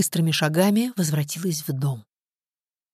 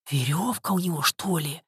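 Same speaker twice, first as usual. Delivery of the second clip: gasping; 3 dB quieter than usual. A narrator's reading. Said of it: "ахнула Женька".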